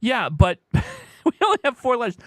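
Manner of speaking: high-pitched, laughing through the words. Real, not acted. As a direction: break up laughing mid-sentence. Amused at own churn mistake.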